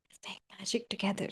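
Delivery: fast intimate whisper, very soft. Shown as a demonstration. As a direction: lean in and whisper it fast, like a secret spell. Repeats line as a whisper.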